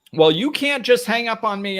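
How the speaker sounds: shrill-free mock outrage, loud and high; angry caller. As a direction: play the outraged caller who got hung up on.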